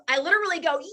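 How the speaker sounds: ordinary talk, nothing marked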